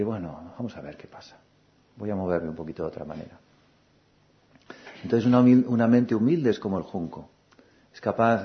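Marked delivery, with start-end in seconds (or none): 0.0-3.4 relaxed, yielding. Retold or imitated. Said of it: "como el junco y decir".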